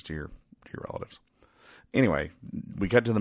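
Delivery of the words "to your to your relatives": whispered aside. Real, not acted. Trails off about relatives.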